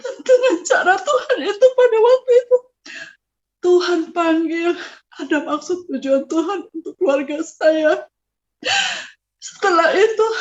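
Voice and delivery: high, choked crying voice; tearful. Real, not acted. Recalling God calling the father, tearfully. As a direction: push through the tears.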